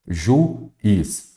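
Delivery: Juiz said as two parts, ju - iz; split into syllables. Shown as a demonstration. Teacher quizzing students on hiatus, word split in syllables. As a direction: break Juiz between the u and the i. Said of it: "você já separa também em sílabas".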